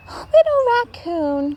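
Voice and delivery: high caring mother voice; mother calling. A narrator's reading. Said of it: "she said".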